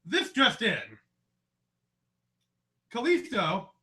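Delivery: loud, punchy news announcer. Shown as a demonstration. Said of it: "Now use your announcer voice and say it".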